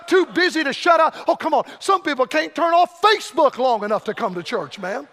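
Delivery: loud, high revival preaching; preaching at full force. Acted. Evangelist fires up the revival crowd, preaching at full force. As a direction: preach it out to the whole congregation.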